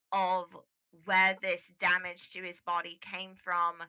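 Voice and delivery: low, hushed doctor's voice; cautious doctor. Acted. Doctor explaining why the patient must rest, low and measured.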